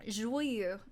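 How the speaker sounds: Joyeux carefully copied in French, zh-wa-yuh; French pronunciation. Shown as a demonstration. Player copying the translator, French pronunciation of Joyeux.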